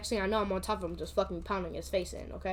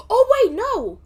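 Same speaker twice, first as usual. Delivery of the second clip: loud, high exclamation of correcting yourself; real, high-pitched jolt of remembering; sudden realization; 13 dB louder, slower than usual. Real, not acted. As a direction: blurt it out as the memory hits. Suddenly remembers the second fight.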